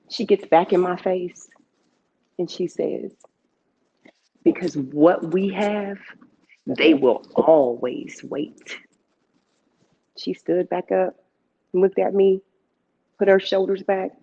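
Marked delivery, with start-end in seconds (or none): 4.4-8.8 slow, low, weighty words; solemn lesson. Retold or imitated. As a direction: let each word land slowly. Aunt's solemn lesson in face.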